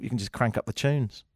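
usual speaking voice

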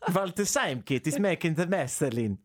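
mock German-accented film dub; comic put-on German accent; German voiceover dub. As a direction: play a dubbed TV character with a German accent. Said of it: "his name in Germany when they do the".

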